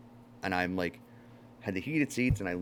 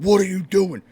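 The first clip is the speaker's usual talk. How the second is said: high, loud mock yell of a cop; hammed-up shouting cop; retold cop scream; 9 semitones higher, 8 dB louder than usual. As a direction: yell it like an angry cop in his face. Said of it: "Screaming in my face".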